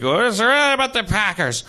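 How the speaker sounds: imitating a slurred drunk voice; lazy slurred words, tipsy; mock drunk slur. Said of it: "my brain's desire to slur and go like".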